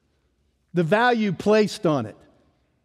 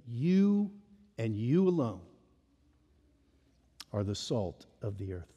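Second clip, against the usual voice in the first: quiet; 9 semitones lower, 10 dB quieter than usual. Shown as a demonstration. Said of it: "it's emphatic".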